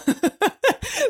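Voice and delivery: high-pitched giggle; a bright burst of laughter. Real, not acted. Laughs at herself.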